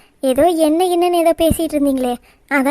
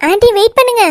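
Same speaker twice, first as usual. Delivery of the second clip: high, loud call after someone running off; 8 semitones higher, 5 dB louder than usual. Acted. Girl calling after the fleeing aunty, high loud call. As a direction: shout after her to wait.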